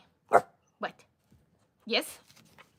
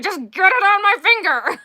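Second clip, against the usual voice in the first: retold yell; 10 semitones higher, 10 dB louder than usual. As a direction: shout it like the ring is stuck mid-ceremony. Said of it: "I was like".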